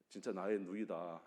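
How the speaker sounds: normal talking voice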